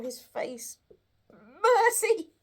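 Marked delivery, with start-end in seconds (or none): 1.3-2.3 terrified plea. Acted. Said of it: "Scrooge fell upon his knees".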